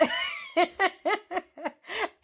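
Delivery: breathy high laugh. Real, not acted. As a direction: let a high laugh slip out. Laughing after "I'm just fat.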